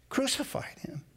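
hushed whisper; whispered, grave words. Acted. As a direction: breathe it out. Climax of the crucifixion reading.

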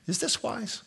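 "Is this wise" asked high and soft, lifting up; questioning inflection. Shown as a demonstration. Pastor teaching graduates a question to ask, questioning inflection on "Is this wise". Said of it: "Do you notice my inflection".

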